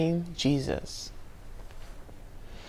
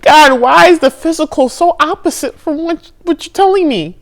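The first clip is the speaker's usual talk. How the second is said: higher and louder, pleading and crying out; desperate plea to God; 8 semitones higher, 6 dB louder than usual. Retold or imitated. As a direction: cry out to God in despair. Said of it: "I really broke down".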